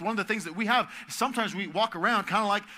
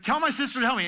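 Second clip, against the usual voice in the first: high, loud mock demand; imitating a bossy sister; upset Martha; 8 semitones higher, 6 dB louder, faster than usual. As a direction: play bossy Martha, pitched up. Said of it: "She's like".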